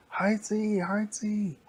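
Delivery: high loud shout for High C; calling out. Acted. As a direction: yell his name across the park. Big girl calling the monster.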